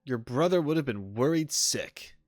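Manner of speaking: ordinary delivery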